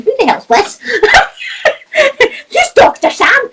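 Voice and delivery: high-pitched baffled quote through laughter; laughing as you mimic someone bewildered; laughing mock disbelief. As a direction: imitate puzzled viewers, cracking up mid-question. Joking mock quote of baffled viewers.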